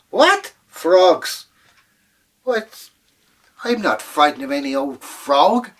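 very high, loud "What? Frogs?"; old woman scorning the leprechaun's frogs; scoffing old woman. Acted. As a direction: scoff in disbelief.

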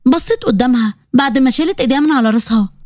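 ordinary delivery

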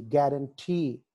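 Guarantee with a long, stressed "tee"; slow guarantee, weight on "tee"; last-syllable stress. Shown as a demonstration. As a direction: draw out the end of guarantee. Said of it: "ఈతో ముగిసింది కాబట్టి last syllable".

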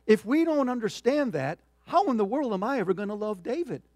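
impassioned preaching. Real, not acted. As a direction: press the congregation hard, loud and high. Impassioned rhetorical questions closing the point.

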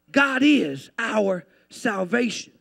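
slow, full-voiced proclamation; emphatic declaration. Real, not acted. Preacher declares salvation to the church, slow and emphatic. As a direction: proclaim it word by word to the congregation. Declaration, then hushed repeat.

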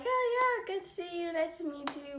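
high, soft acted pleasantries; polite small talk. Meeting online acquaintances face to face, polite and high. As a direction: lift the voice into light, quiet greetings.

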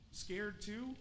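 usual speaking voice

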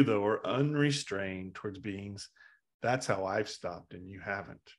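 quietly deliberate acted pronouncement; calm teaching. Buddha explaining to the bandit how he stopped, calm.

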